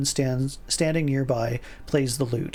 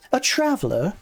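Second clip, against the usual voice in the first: higher, louder doubtful question; disbelieving repeat; incredulous traveler?; skeptical echo; 6 semitones higher, 4 dB louder than usual. Acted. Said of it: "she says, looking you over".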